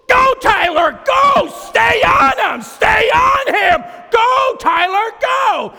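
full-volume, high, drawn-out shout; frantic coach shouting from the course; upset coach yelling. Retold or imitated. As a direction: bellow at your runner to speed up. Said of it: "He was so upset with me".

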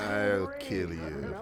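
low, slow, hushed threat; a slow creeping horror-villain menace; menacing villain. Acted. Villain threat, Vincent Price.

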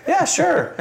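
high, breezy giving in; pushover yes. Retold or imitated. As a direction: give in without a fight. Dad caving to son.

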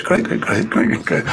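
soft whispered great great great; whispered to yourself. Shown as a demonstration. Speaker shows whispering great to yourself, in a soft whisper. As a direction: whisper it under your breath in quick bursts. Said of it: "just say the word great to yourself".

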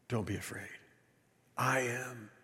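soft, low and solemn; hushed reassurance. Acted. Jesus to frightened disciples on the lake, hushed. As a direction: drop low and quiet to calm them.

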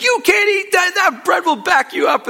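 high, loud mock shriek of scolding; piercingly high comic yell; imitated wife's scream. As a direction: scream the warning like a bickering old wife. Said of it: "And she just screams it".

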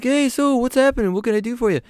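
very high British voice; friendly and way up high. Retold or imitated. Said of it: "He's like".

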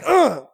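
loud, high mock grunt of effort; effortful grunt. Boy forcing his hand up in church, strained grunt. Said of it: "And I'm like".

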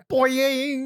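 mock boing. Mimicking a spring-up sound.

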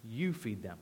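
low, soft instruction; matter-of-fact command. Retold or imitated. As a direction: say it low and quietly, no push. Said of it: "makes a rather ridiculous request".

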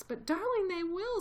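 high, tearful plea; a mother near tears, voice lifted high; sobbing. A narrator's reading. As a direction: Missus Fox sobbing to her husband. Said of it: "sobbed Missus Fox".

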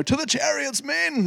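high-pitched mock rallying cry; angry army commander. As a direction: play an angry commander calling troops to arms. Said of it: "all angry".

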